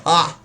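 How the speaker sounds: loud gloating Ha; triumphant ha. Real, not acted. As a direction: crow over catching them.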